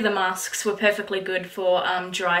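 talking through her fingers; muffled behind hand; hand over mouth. Real, not acted. Seen 1: Fingers cover mouth from 1.1 to 3.2s; sheet mask also worn.

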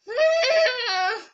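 long, loud high whine; high-pitched squeal. Real, not acted. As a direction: let out a drawn-out high cry. Wordless outburst during play.

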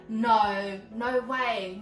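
ordinary delivery

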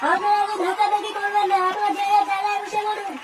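formal emcee calling to a crowd; stage announcer. Acted. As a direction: announce the show to a restless audience. (মঞ্চের ঘোষকের ভঙ্গিতে)

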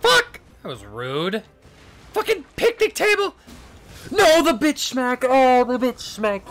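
loud, high raging outburst at the game; furious yelling. Real, not acted. Raging at the boss hit.

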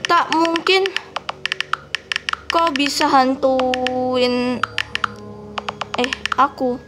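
slow, drawn-out disbelief; slow read-out. Shown as a demonstration. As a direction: stretch kau bisa hantuin out slowly. Reading her own typed reply.

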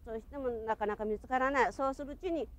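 ordinary talk, nothing marked

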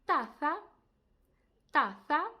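Taza with a th in the middle; Spanish z as th. Shown as a demonstration. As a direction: say Taza as tatha, tongue between the teeth. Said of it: "Наприклад, у таких словах".

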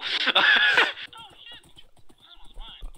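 long high giggling laugh; real high-pitched laughing fit; high cackle. Real, not acted. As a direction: burst into a squeaky laugh. Laughing at own move-in joke.

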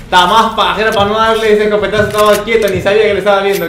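fast, high, loud self-reproach; exasperated rant. Real, not acted. Gamer who missed an easy shot, exasperated rant. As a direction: rattle it out in exasperation.

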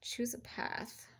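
slow, hushed whisper. Real, not acted. Reader announcing a card's message, whispered. Reading cards softly to herself.

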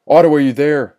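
loud, high, anxious question; yelled call. A narrator's reading. Levi calling to silent Otto from his cell, high loud yell. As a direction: call out searching for an answer. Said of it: "yelled Levi".

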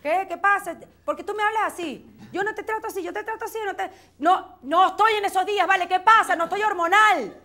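high, loud indignant denial; hormonal outburst. Acted. Woman accused of being hormonal, indignant loud denial. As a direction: snap back, offended and defensive.

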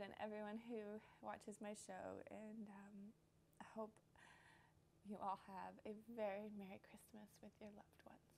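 very soft, halting, near whisper; hushed, holding back tears; choked-up gratitude. Real, not acted. Host wishing viewers Merry Christmas, quietly choked up. Tearful thanks and Christmas wishes.

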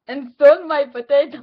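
fake-cry it, fighting back laughter; mock sobbing. Prank caller reporting a stolen potato, mock sobbing. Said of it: "I'm just so sad".

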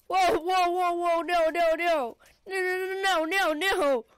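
frantic yelps as danger closes in; panicked. Real, not acted. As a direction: panic at being cornered.

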